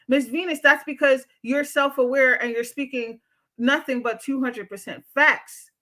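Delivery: normal talking voice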